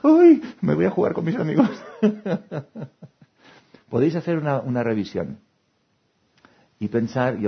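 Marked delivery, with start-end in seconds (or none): 0.0-1.7 put-on higher, louder, laughing kid voices. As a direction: giggle through the children's lines. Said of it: "O al revés". (hijos contestando entre risas, más agudo)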